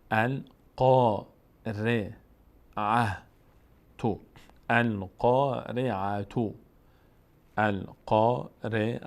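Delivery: القارعه very slow, syllable by syllable, with a rounded "qaw"; full heavy vowel. Shown as a demonstration. Teacher models the word with full aa, slow syllables. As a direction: spell out القارعه تو slowly, stretching the heavy aa and ending on "tu".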